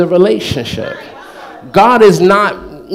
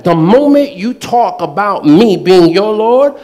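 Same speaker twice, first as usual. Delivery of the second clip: normal talking voice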